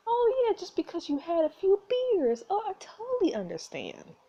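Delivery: high, put-on mock agreement; sarcastic. Player mocking the drunk's excuse, high sarcastic sing-song. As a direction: sneer back his excuse in a high fake-sympathetic voice.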